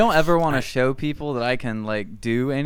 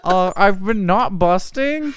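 slow, higher mock Peterson lecture; mock Jordan Peterson; 7 semitones higher, 4 dB louder, slower than usual. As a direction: drag it out like a smug guru. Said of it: "That sounds like some Jordan Peterson shit too".

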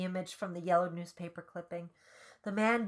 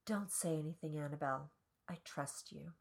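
very soft, hushed and low in volume; whispering; 3 semitones lower, 10 dB quieter than usual. A narrator's reading. Calvin telling Annabelle he trusts her, whispering. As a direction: drop to a hush, close and quiet. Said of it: "he whispers".

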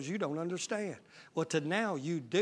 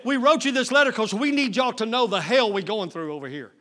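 high, loud desperate plea; urgent shout; 6 semitones higher, 9 dB louder than usual. Acted. Preacher shouting Paul's plea to the church, loud and desperate. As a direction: cry out the apostle's distress.

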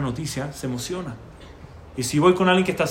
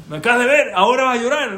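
mock incredulous; 8 semitones higher, 7 dB louder than usual. Mocking Yosef crying now.

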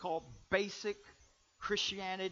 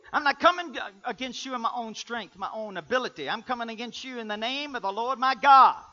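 loud preacher's declaration, rising in force; full-force preaching; 7 semitones higher, 13 dB louder than usual. Acted. Preacher voicing David's challenge to Goliath, full-force preaching.

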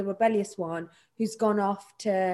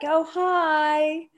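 a touch of a higher, put-on sweet hello; fake polite greeting; 5 semitones higher, 4 dB louder than usual. Auntie greets someone she dislikes with mild put-on politeness. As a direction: greet them nicely while hiding dislike. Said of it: "That's just so Indian".

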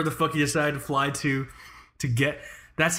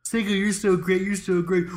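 fawning nice guy; 6 semitones higher than usual. Pretend.

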